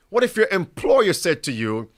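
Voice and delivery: ordinary talk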